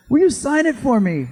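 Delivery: high, loud eager ask; eager request. Retold or imitated. Fan asks Bruce to sign his book, high and eager. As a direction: beg sweetly for the autograph.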